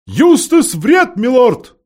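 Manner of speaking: high, loud, slow owl shout; owl shouting with all her might. A narrator's reading. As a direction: yell it for the deaf lord. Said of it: "изо всех сил прокричала сова".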